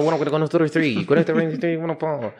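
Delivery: imitação aguda de leiloeiro da Louisiana; leiloeiro de gado. Acted. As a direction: dispare números como num leilão de boi. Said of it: "você ouve o leilão de, de boi". (leiloeiro de boi, voz mais alta e cantada)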